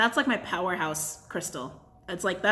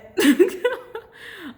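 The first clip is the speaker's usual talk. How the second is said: sudden high, bright burst of laughter; quick high giggle, louder than the talk; 12 semitones higher, 7 dB louder than usual. Real, not acted. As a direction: laugh out loud, caught off guard. Burst of laughter mid-reading.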